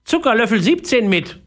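high, demanding parrot. A narrator's reading. Parrot demands sugar from Philipp, high bossy voice. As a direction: add the demand in a squawky bird voice. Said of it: "sagte der Papagei".

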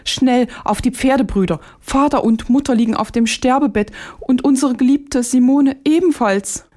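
high-pitched alarm, urgent; frightened cry. A narrator's reading. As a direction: cry out the terrible news in fright. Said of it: "so schrie er erschrocken auf".

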